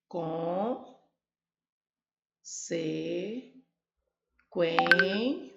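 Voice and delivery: con-se-quen- drawn out very slowly, each syllable apart. Shown as a demonstration. Teacher splitting the word for students, slow syllables. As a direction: spell out con-se-quen- in slow separate beats. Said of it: "Como eu separo a sílaba da palavra consequência?".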